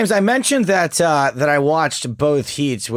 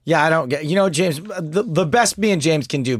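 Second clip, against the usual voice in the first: ordinary talk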